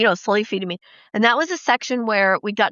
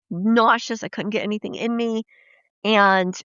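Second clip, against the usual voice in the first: normal talking voice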